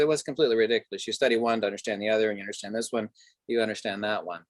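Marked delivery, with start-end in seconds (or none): none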